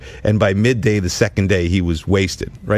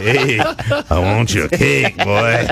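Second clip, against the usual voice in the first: mock creepy stranger; 3 semitones higher than usual. Joking how he sounded to the boy in a hoodie. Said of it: "I was like".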